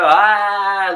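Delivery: put-on a short angry wail like a crying toddler; mock tantrum wail; imitated child's cry. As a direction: mimic a child's frustrated cry. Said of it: "they go ... like this".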